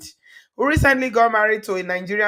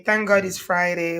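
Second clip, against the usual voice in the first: usual speaking voice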